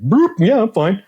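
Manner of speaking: high-pitched, chirpy people-pleaser blurt. Retold or imitated. Man reassuring his girlfriend while secretly seething, high people-pleaser voice. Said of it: "the people pleaser in my brain popped out".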